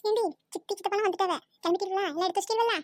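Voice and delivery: normal talking voice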